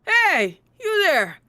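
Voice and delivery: loud hail across the street; calling out. Acted. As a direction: call to get his attention. Officer calling out to stranger.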